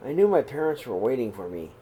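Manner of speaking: calm, even read-aloud voice; steady storybook telling; story narration. A narrator's reading. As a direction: read the story gently to the kids. Reads picture book aloud.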